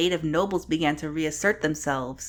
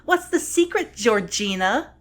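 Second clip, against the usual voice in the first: high-pitched put-on questioning voice; imagined questioner; 7 semitones higher than usual. Imagined viewer asking about strong verbs, mock inquisitive. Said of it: "you may be asking".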